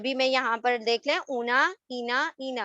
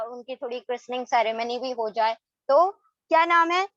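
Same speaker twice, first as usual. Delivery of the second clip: normal talking voice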